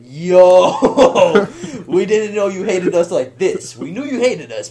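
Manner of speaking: loud, high protest breaking into laughter; hammed-up high-pitched appeal; mock outraged disbelief. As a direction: cry out in exaggerated shock, laughing. Protesting as car is bashed.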